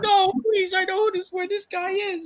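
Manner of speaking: very high falsetto plea; hammed-up squeal, pleading for your life; squeaky mock begging. Voicing Vario begging again.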